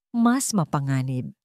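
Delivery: usual speaking voice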